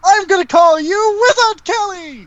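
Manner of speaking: very high, grand proclamation; excitable wizard creature. Acted. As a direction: bestow a new name in a high wizard screech. Wizard creature renames a player.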